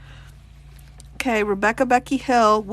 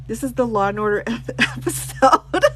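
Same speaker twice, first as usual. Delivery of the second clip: laughing. Real, not acted. Cracks up at the book.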